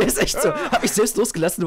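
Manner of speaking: words broken by high laughter; laughing. Real, not acted. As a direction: laugh through the line. Laughing at the death.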